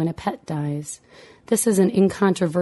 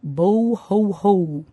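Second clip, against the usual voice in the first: slow, playful sobbed syllables; a child toying with fake crying; slow mock blubbering; pretend sobbing; 3 semitones higher, much slower than usual. A narrator's reading. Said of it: "a little later we heard".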